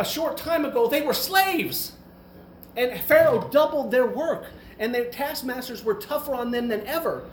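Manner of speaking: imitated exasperated rant, rising in pitch; fed-up God. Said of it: "God had had enough".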